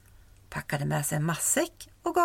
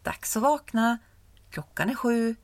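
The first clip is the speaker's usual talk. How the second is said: lightly voiced mother rousing her child; a hint of a mum calling up the stairs; 6 semitones higher than usual. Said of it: "hans mamma som ropade".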